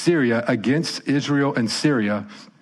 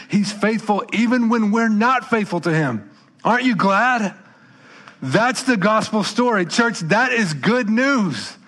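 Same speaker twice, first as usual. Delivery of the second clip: rousing preaching; 7 semitones higher than usual. Acted. Preacher rallying his church with the good news, rousing and rising.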